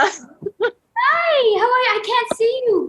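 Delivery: high, eager hello; excited greeting. Real, not acted. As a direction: greet the fan with real delight. Host greets a fan.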